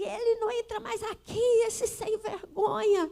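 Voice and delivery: furious sister. Retold or imitated.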